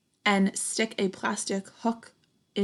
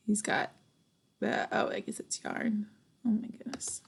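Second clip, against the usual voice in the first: unvoiced whispered aside; hushed aside; 6 dB quieter than usual. Real, not acted. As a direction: whisper it to yourself. Storyteller mutters aside.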